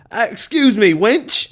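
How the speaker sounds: attempted English accent, pitched high; a failed try at Australian sliding into dirty English. Shown as a demonstration. Struggling player attempting a card, high dirty English accent. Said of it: "like, dirty English".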